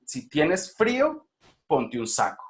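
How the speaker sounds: FRÍO climbs high and drawn out, then SACO drops; rise then fall. Shown as a demonstration. Teacher showing the melody of conditional sentences, rise then fall. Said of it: "esta melodía".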